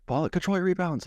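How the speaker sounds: high, barked coaching call; mock coach yell. Coach barking at a goalie after a rebound, mock yell. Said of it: "you're like".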